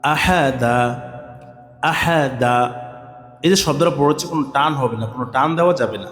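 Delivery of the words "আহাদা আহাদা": slow, sing-song letter-by-letter spelling; slow spelling drill. Shown as a demonstration. Teacher shows students how to join letters, slow spelling chant. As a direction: spell it out slowly, then join the syllables. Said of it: "দুটি করে হরফ মিলাবেন".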